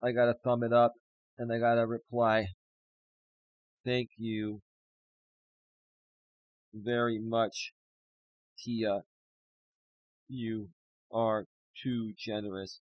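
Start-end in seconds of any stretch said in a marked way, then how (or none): 3.8-12.8 much softer and lower, breaking up; real tearful gratitude; choked thanks. Real, not acted. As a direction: squeeze the words out through tears. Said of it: "I'm losing my shit".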